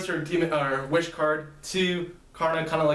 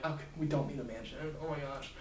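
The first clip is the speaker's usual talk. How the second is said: exasperated mutter; 12 dB quieter than usual. Acted. Akane muttering as they leave.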